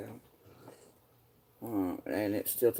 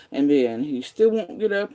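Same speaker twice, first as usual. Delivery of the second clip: angry complaint; 4 semitones higher, 8 dB louder than usual. Real, not acted. Repeating complaint, escalating.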